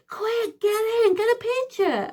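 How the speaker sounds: high-pitched, comic urgency; imitated urgent call. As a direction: mimic him hurrying someone in, voice way up. Acting out Dougie urgently calling her over.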